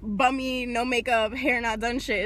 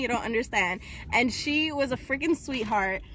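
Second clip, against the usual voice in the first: ordinary talk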